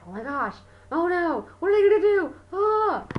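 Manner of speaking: rising high, loud panicked gasps; mock panic. Watching the suspenseful movie, mock panic building.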